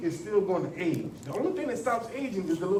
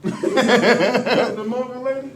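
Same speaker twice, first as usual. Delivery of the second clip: slow question through laughter; laughing; 6 semitones higher, 10 dB louder, much slower than usual. Real, not acted. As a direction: laugh while asking. Laughing at a joke.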